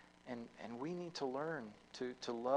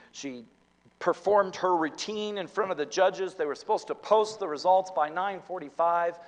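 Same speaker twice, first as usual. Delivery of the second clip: loud, quick, higher preaching; excited sermon storytelling; 7 semitones higher, 15 dB louder than usual. Acted. Preacher builds suspense for the congregation, loud and quick. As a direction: race toward the results.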